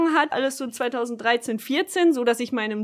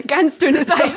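laughing; 4 semitones higher, 6 dB louder, slower than usual. Acted.

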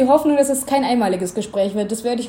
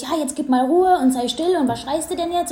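mock annoyed scolding, higher; annoyed parent; 5 semitones higher than usual. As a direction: imitate an irritated parent snapping at a crying child. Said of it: "so in einem richtig genervten Ton". (genervt nachgeahmter Elternton, höher)

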